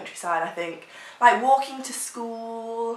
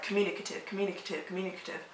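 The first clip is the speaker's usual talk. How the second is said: communicative, communicative, communicative chugged like a train, fading softer; 10 dB quieter, much faster than usual. Shown as a demonstration. Girl chugging the word like a train, softer and softer. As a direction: repeat communicative like a train rolling away, each softer. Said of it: "It almost sounds like a train".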